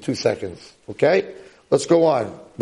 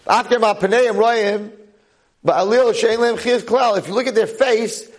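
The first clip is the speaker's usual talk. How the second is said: high-pitched, impassioned declaiming; full-force preaching; 9 semitones higher, slower than usual. Real, not acted. Rabbi voicing the depressed learners' question, preaching hard. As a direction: declaim the text and its translation fervently.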